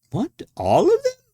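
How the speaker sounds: high, loud, startled question; astonished. A narrator's reading. Guest reacting to the women, astonished. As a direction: shoot up in disbelief.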